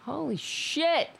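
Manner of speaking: high, slow exclamation; startled by what she sees; drawn-out high holy shit; shocked. Real, not acted. Reacting to discovery.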